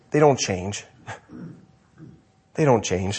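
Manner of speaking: low, soft solemn repetition; grave hush. Acted. Preacher stresses an unchanging message, low and soft. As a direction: drop low and quiet, let it sink in. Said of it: "lowered repetition".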